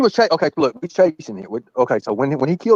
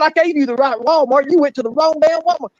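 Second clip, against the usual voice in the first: retold scolding; 12 semitones higher than usual. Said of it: "I said".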